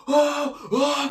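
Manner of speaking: high, excited "oh, oh" from onlookers; crowd oohing. Retold or imitated. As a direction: hype up the fight like a crowd. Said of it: "People are like".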